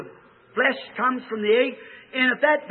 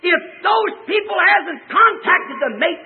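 loud, slow preacher's cry; fiery preaching; 7 semitones higher, 4 dB louder, slower than usual. Real, not acted. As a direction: drag it out and shout it. Preaching at full force.

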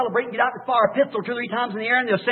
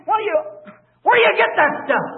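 much higher, incredulous cry; exasperated disbelief; 11 semitones higher than usual. Real, not acted. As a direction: throw up your hands at the nonsense. Preacher exasperated at churches.

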